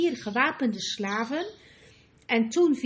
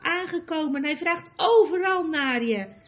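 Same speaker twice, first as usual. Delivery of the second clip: higher, animated telling; gleeful chatter; 5 semitones higher, 5 dB louder than usual. A narrator's reading. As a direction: share it as a huge joke. Gleeful gossip before a giggling fit. (opgewonden, vol pret)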